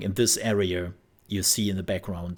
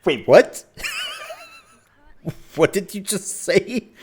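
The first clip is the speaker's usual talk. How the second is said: much higher, louder, laughing disbelief; incredulous; 6 dB louder than usual. Retold or imitated. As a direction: react as if you misheard. Reacting to absurd dubbing.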